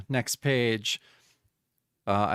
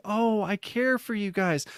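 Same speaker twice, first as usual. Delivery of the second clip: high, gentle caring line; kind, caring; 8 semitones higher than usual. Acted. Doctor comforting the monsters, high and gentle. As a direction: soothe them like a kind nurse. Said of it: "she's very kind".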